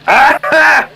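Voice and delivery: high sharp yelp of pain. Real, not acted. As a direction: yelp as the nose burns. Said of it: "there was a piece of pepper up there".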